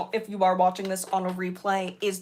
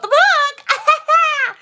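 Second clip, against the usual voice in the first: squealed emphasis; 13 dB louder than usual. Pretend. Exaggerated emphasis joke.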